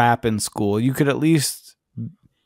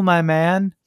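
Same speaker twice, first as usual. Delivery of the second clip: ordinary talk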